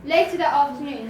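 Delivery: ordinary delivery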